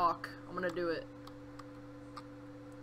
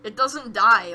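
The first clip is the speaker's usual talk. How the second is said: ordinary delivery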